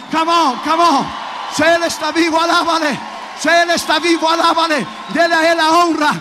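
rally the congregation to mission; full-force preaching. Acted. Calls congregation to praise. (predicación a todo pulmón)

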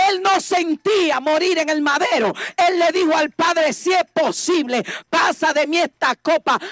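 impassioned preaching in punched phrases; prédica apasionada. Real, not acted. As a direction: preach it forcefully, each clause driven home. (prédica apasionada y enfática)

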